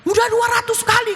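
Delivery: high-pitched imitation of exasperated children; children protesting. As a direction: whine it upward as a child's protest. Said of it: "Sampai anak-anak bilang gini". (menirukan protes anak bernada tinggi)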